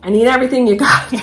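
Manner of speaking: fast, loud and laughing; urgent plea at the lumber yard; laughing, urgent demand. Retold or imitated. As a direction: rush it out like a desperate customer. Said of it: "I'm like".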